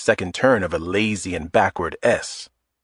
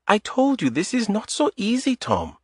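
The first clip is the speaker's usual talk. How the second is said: put-on guarded, higher voice with a light Eastern European accent; tense, light Eastern European accent; 11 semitones higher, 3 dB quieter than usual. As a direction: Adita stiffening at his request, light Eastern European accent. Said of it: "Adita stiffened".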